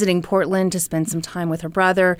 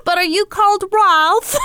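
high-pitched mock British woman; British accent; 11 semitones higher, 3 dB louder than usual. Blonde stranger asking Ralph his name, high mock British accent. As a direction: ask it in an exaggerated British accent. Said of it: "my imitation of her British accent".

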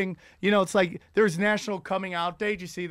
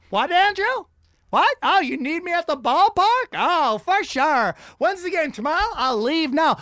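Vin Scully eager; 7 semitones higher than usual. Acted. Retired announcer pretends the team needs him. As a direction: overplay the excitement to get out the door.

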